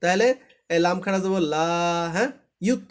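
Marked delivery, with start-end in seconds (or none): 1.5-1.7 "লা" held long on the aa; sustained recitation-style "লা"; one-alif stretch. Shown as a demonstration. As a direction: draw out the vowel of "লা". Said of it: "একালিফ, একালিফ".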